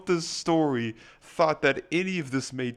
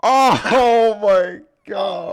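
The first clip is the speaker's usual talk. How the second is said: loud, drawn-out shocked gasp; stunned disbelief, stretched out; 7 semitones higher, 13 dB louder, much slower than usual. Real, not acted. Shocked reaction to twisted story.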